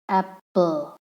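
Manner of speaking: "apple" in two separate syllables; split into syllables. Shown as a demonstration. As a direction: say "apple" with a small gap before the second syllable. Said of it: "apple has two syllable".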